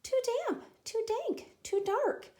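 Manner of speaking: high squeaky little mouse. Acted. Mouse complains about the bear's cave, high squeak. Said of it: "Mouse squeaks".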